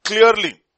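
"Clearly" said high and loud with a falling tune; emphatic Clearly. Real, not acted. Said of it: "I like that word".

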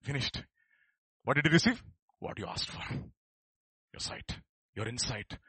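ordinary talk

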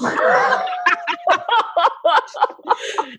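high shouted laugh. Real, not acted. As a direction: cackle at own joke. Laughing at own joke.

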